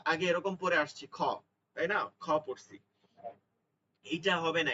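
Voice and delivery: ordinary talk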